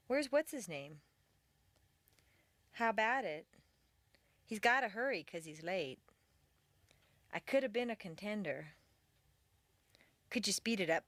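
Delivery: words linked and squeezed, as in "gotta", "wanna", "coulda"; casual reduced speech. Shown as a demonstration. Teacher models casual speech for repeating, with reduced, linked words like "gotta". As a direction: run words like "gotta" and "shoulda" together casually. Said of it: "force yourself to talk this way".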